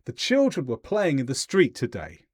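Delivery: tone steps down through "street today"; falling intonation. Shown as a demonstration. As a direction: finish "today" low, as a completed statement. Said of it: "I went down".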